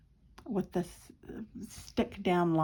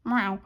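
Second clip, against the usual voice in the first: imitated short meow. Cat snubs Mum before walking away, curt meow. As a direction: give a curt little meow. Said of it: "and she'd go".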